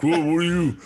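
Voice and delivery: loud startled Whoa from the brother; brother's exclamation. Retold or imitated. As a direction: exclaim it like the shocked big brother. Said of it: "He's like".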